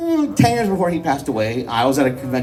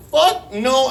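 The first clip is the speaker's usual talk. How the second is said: high, stretched mock horror; horrified refusal; 10 semitones higher than usual. Son hearing dad's confession, high horrified outburst.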